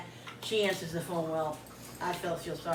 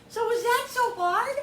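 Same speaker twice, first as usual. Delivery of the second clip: high, louder rhetorical question; exasperated; 6 dB louder than usual. Real, not acted. Venting to viewers after the manager finally helped, exasperated. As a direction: pitch it way up in indignant disbelief.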